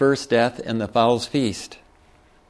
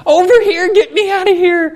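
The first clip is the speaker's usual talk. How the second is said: much higher, louder excited shout; eager call for rescue; 6 dB louder than usual. Acted. Saved believers calling to Jesus, excited high shout. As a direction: wave down the rescuers, thrilled. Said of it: "God's side's gonna be running out and going".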